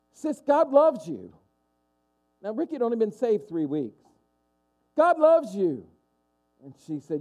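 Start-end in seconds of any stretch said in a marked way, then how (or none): 0.1-1.3 high, slow, emphatic reassurance; earnest insistence. Acted. Brother to his upset, scared sister, earnest insistence. 4.9-5.9 very high, loud reassurance; emphatic insistence. Acted. Newly saved brother repeats it to his sister, emphatic insistence.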